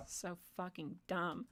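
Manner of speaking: slow, whispered contempt; under the breath. Real, not acted. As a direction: whisper it slowly to yourself in disbelief. Muttered disgust to oneself.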